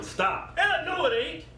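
ordinary talk

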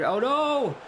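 a gasp of a near miss; surprised cry. Real, not acted.

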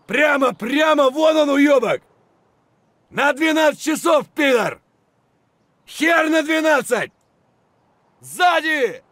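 loud cursing yell at the enemy; angry battle shout. Acted. Soldier spotting enemy, angry shout. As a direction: curse him out over the gunfire.

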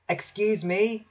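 lightly voiced little engine asking politely. A narrator's reading. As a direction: ask nicely in a small high voice. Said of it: "Percy peeped politely".

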